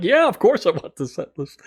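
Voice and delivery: high, excited retold answer; eager yes. Fan answering the stagehand's offer, eager yes. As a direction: jump at the offer, pitched well up.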